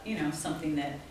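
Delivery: ordinary talk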